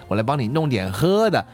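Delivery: ordinary delivery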